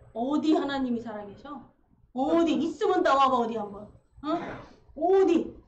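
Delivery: loud, scornful taunt at God; defiant challenge. Acted. Dying doubter taunting God, defiant. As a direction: dare God to show up.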